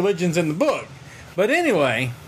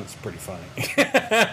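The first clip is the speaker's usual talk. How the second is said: low, slow deadpan with a chuckle; brooding hero cracking a joke; deadpan Batman; 9 semitones lower, slower than usual. Retold or imitated. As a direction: drop the voice way down and say it dry. Batman voiced in retold comic.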